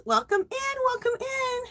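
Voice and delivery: very high welcome; high singsong greeting. Real, not acted. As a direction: lilt it up high and sweet. Greeting viewer.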